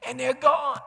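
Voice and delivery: very high, loud outcry; preaching cry. Real, not acted. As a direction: cry it out in alarm.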